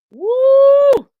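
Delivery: a loud, high whoop of delight; excited whoop. Real, not acted. Host whoops in delight at a viewer's comment, loud and high.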